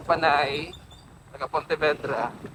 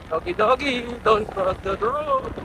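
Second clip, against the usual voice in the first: talking to a dog; 11 semitones higher than usual. Real, not acted. Calling to a dog.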